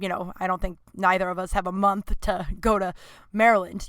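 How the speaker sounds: usual speaking voice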